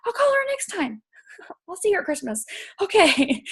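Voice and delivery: high, breezy brushing-off of guilt; mock self-talk excuse. To herself, dodging the call to Aunt Donna, high and breezy. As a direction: airily talk yourself out of the call. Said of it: "So I'm like".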